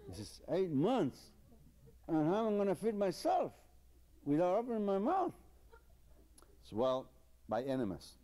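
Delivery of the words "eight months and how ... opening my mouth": shocked patient. Acted. Patient echoing the doctor in high alarm. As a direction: throw it back in disbelief.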